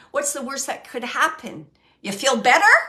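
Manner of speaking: teasing sarcasm that tips into laughter; playful sarcasm. Retold or imitated. Medium relays a spirit teasing her loved one, playful sarcasm. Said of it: "She's being a little sarcastic".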